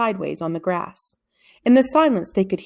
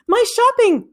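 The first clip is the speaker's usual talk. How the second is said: high, loud cry for help; old woman calling out; 6 dB louder than usual. A narrator's reading. Old woman calling for help in the park, high and loud. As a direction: shriek it out like a robbed old lady. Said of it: "called to anyone who could hear".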